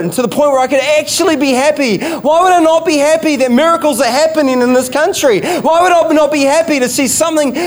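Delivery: preacher building up steam; fervent preaching. Acted. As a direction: drive the point home to the congregation. Preaching with rising fervour.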